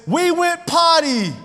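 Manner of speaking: high, slow toy announcement; toy potty voice. Retold or imitated. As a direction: read it like a cheerful toy. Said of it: "it says".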